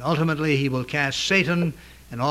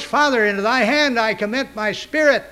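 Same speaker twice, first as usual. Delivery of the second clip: raised, higher voice of a strong man; loud cry; 7 semitones higher than usual. Retold or imitated. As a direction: cry it out strongly, pitched well up. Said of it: "Cried with a loud voice".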